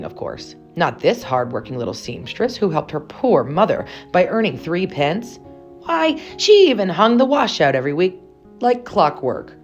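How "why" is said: plain reading voice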